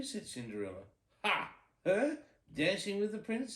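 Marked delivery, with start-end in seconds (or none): 1.2-3.5 high, loud, sneering scoff; mock her as a cruel stepsister; cruel mockery. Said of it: "laughing cruelly".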